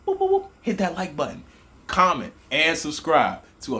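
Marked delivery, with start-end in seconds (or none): 0.1-0.5 very high pointing boops; playful boops. Acted. Host pointing viewers to the like button, squeaky high.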